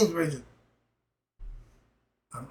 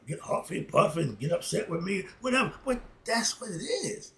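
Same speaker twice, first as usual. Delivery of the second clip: normal talking voice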